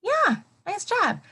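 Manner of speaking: excited praise. Real, not acted. Praising a student.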